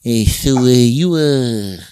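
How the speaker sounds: lightly put-on East Coast street guy, drawn out; a hint of a slow East Coast wise guy. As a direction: drag it out like a neighborhood guy. Acted quote of fellow East Coaster.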